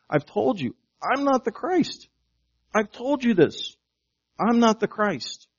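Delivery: high-pitched, exasperated-sounding insistence; insistent reminder. Acted. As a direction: plead the point with rising pitch, as if repeating it to forgetful followers. Voices John reminding his disciples.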